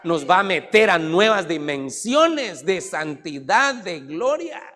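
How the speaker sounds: rising, forceful declarations; preaching. Real, not acted. Preaching builds to full force.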